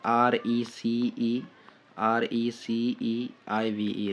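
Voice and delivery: very slow, spaced-out letters; spelled out slowly. Shown as a demonstration. Teacher spells an important word to memorise, very slowly. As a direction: dictate letter by letter, leaving gaps.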